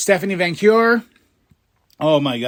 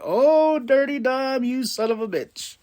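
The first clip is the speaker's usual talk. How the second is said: mock scandalized; 10 semitones higher than usual. Fan reacting to Dom's scheming, high mock outrage.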